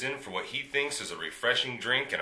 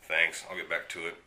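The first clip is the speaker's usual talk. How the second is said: ordinary talk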